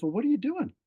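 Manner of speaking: high-pitched, baffled question; puzzled questioner. Retold or imitated. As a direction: imitate a stranger questioning in disbelief. Lady questions him at convention.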